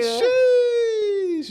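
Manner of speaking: loud, high-pitched sheesh; high shout. Real, not acted. Teasing cry after prank.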